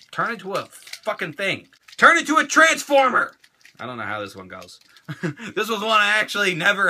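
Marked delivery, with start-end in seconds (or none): 0.1-1.7 softly grumbling at a toy; quiet frustration. Real, not acted. Struggling to transform the toy, quiet grumble. 2.0-3.3 higher, loud comic command; exasperated shout. Pretend. Ordering the toy to transform, exasperated shout.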